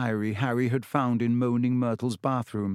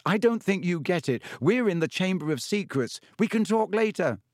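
higher, pressing, losing patience; impatient boy; 7 semitones higher than usual. A narrator's reading. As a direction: cut him off, higher and impatient. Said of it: "losing patience".

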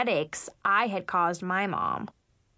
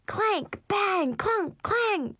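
high-pitched, punched noise words; imitated clanging; 10 semitones higher, much slower than usual. A narrator's reading. Little sister making kitchen racket, clanging. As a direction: hit each word like a banging pan.

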